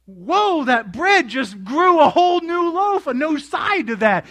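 loud, high-pitched astonishment; amazed onlooker. Retold or imitated. Disciples watching the bread multiply, loud amazement. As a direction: exclaim it as if witnessing a miracle. Voices amazed miracle witnesses.

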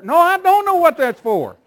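put-on high, loud whiny kid pleading; child's protest. Child protesting to the parent that he doesn't know. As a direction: wail it like a clueless kid.

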